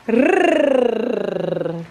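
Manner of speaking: very long trilled "Rrr"; rolled R. Shown as a demonstration. Mother challenges kid to roll the R, "Rrr" long trill. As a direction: keep the tongue rolling all through "Rrr". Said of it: "Can you roll your R?".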